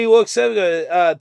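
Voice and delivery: ordinary delivery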